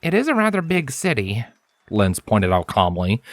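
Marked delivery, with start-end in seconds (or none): 0.0-1.4 higher, earnest scholarly stallion, serious; Lens's voice. A narrator's reading. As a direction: speak as the studious unicorn, intent on his work. Narrator voices Lens.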